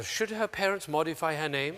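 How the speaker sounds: ordinary talk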